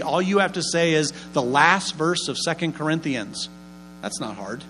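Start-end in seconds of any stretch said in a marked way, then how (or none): 4.0-4.6 much softer, offhand reassurance; soft aside. Real, not acted. Preacher reassuring the congregation, quiet and offhand. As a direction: drop it quietly like a secret.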